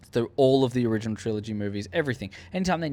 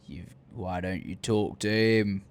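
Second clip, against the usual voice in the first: sinister trooper; 5 semitones lower, 4 dB quieter, slower than usual. Retold or imitated. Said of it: "when he's like".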